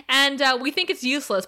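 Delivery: ordinary talk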